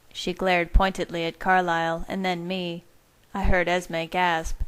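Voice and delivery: usual speaking voice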